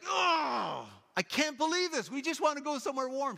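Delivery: high whiny complaint; exasperated. Retold or imitated. Mom stuck in traffic, whining high and exasperated. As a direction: moan at being stuck again. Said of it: "And she's like".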